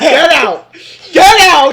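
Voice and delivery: loud shouted command; shouting. Pretend. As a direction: bellow it out. Shouting at co-host jokingly.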